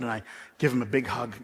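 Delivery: ordinary talk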